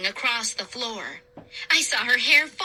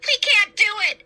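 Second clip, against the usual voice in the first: much higher, louder despair; despairing cry; 9 semitones higher, 4 dB louder, faster than usual. A narrator's reading. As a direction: cry out in frustrated terror. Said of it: "I cried, so disappointed".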